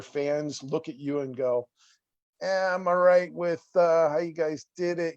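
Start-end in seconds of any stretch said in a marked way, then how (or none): 2.4-5.1 mock Chicago accent, pitched a bit higher; a weak stab at a Chicago accent; bad Chicago accent. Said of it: "I do a bad Chicago accent".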